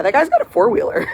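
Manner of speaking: amused laugh through the words. Real, not acted. Laughs spotting neighbour's four-wheeler.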